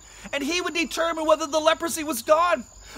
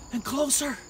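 ordinary delivery

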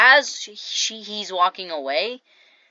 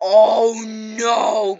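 loud, higher, drawn-out oh no; mock dismay; 4 semitones higher, 9 dB louder than usual. Girl realizing she was bested, exaggerated dismay. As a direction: hold each word in hammed-up dismay. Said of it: "she realized she was bested".

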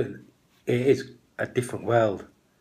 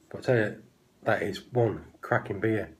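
talking mid-sip; glass pressed to lips; speaking into a glass. Real, not acted. Seen 1: Glass tilted at his lips through every labelled frame, covering mouth.

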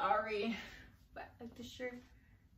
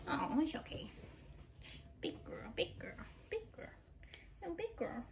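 cooing whisper; 5 semitones higher, 10 dB quieter than usual. Real, not acted. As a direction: whisper sweet nothings to the dog. Cooing to her dog.

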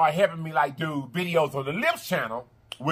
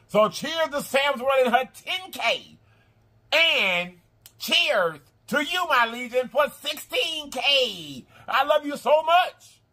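heartfelt toast; 6 semitones higher, slower than usual. Real, not acted. Toasting her viewers.

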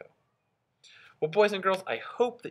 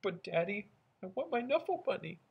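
put-on higher pleading child voice; whiny little girl; 7 semitones higher, 5 dB quieter than usual. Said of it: "I think she's thinking".

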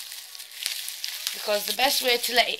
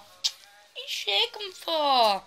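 pet talk; 8 semitones higher, 3 dB quieter than usual. Real, not acted. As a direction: gentler coo at the dog. Asking the dog again.

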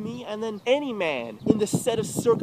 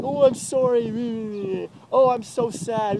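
higher, louder, sarcastic fake sorrow; mock apology; 6 semitones higher, 5 dB louder, slower than usual. Mocking the apologies he tried on his ex, sarcastic. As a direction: sneer out a fake sorry.

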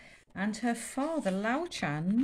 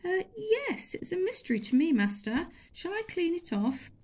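put-on much higher, sweet innocent boy; mock innocent boy; 11 semitones higher than usual. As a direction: feign innocence to master. Chulak feigning innocence.

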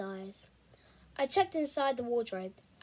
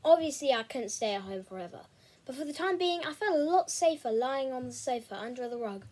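ordinary delivery, nothing marked

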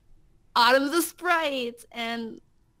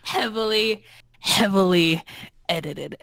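heavily louder each time, then edited drops low; mock dramatic emphasis; 3 semitones lower, 6 dB louder than usual. Guest echoes the editing joke with hammed-up emphasis. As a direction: lean on heavily like a movie trailer.

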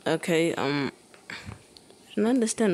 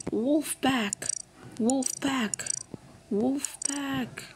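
fading to whisper; 4 semitones higher, 4 dB quieter, much slower than usual. Real, not acted.

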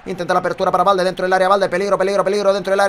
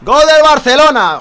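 goal call; 6 semitones higher, 9 dB louder, slower than usual. Real, not acted. Announcing the Barcelona goal.

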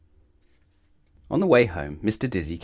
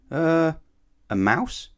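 unsure guess; 9 semitones higher, 3 dB louder, much slower than usual. A narrator's reading. Dizzy stumped by the riddle.

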